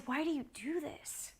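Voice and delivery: whispered, pained disbelief; hushed question. Retold or imitated. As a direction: whisper it as a plea to understand. Asks the worker, retold in hush.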